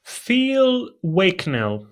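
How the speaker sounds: name said very slowly; slow and deliberate. Real, not acted. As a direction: draw out the name clearly. Names speaker slowly.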